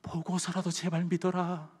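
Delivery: soft, slow, gentle plea; 꾸짖지 않는 예수님의 부탁. Acted. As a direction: plead quietly instead of scolding. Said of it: "그렇게 이야기하지 않고요". (작고 느리게 달래듯)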